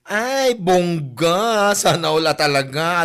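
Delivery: loud, slow drawn-out sarcastic wow; sarcastic awe. Acted. Coworker mocks the lavish treat, loud sarcastic awe. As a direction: gush with fake envy.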